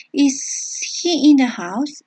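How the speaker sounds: slow, careful English question; slow model question. Shown as a demonstration. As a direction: read it out slowly for students. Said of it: "teacher modeling question slowly". (pregunta modelo lenta y clara)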